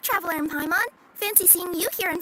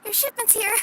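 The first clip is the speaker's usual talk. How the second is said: flustered and breathless; 5 semitones higher than usual. Acted. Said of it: "Deep breaths, Kirara, then start again".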